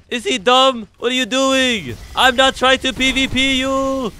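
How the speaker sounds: high, loud frantic protest; exasperated yelling. Real, not acted. Player yelling at Reaper who attacks him, high exasperated shouting.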